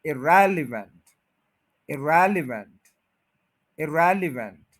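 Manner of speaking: Irrelevant with रेल stressed, ई light; irrelevant, रेल strong; stress on REL. Shown as a demonstration. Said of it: "रेल, ये stress है".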